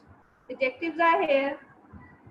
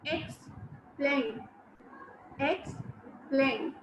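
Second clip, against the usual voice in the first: "Explain" softly broken as EX... PLAIN; long gap between EX and PLAIN in "Explain"; divided in syllables; 6 dB quieter, much slower than usual. Shown as a demonstration. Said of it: "this word is divided in two syllables".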